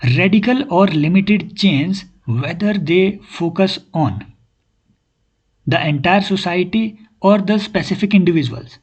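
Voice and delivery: slow read-aloud. Shown as a demonstration. Teacher reads option aloud.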